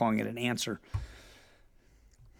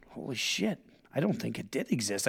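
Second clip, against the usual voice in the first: inner thought; 2 semitones lower, 6 dB quieter than usual. Retold or imitated. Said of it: "And I'm thinking".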